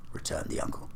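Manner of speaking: ordinary delivery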